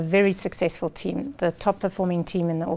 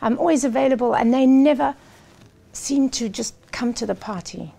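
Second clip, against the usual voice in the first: frustrated outburst; 5 semitones higher than usual. Retold or imitated. Sales executive venting to his coach, exasperated and raised. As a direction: vent like a boss fed up with his team. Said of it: "frustration and anger".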